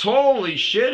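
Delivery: loud, high exclamation of disbelief; amazed outburst. Retold or imitated. Manager hearing the delivery story, loud amazement. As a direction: react with big amazement.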